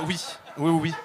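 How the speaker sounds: ordinary delivery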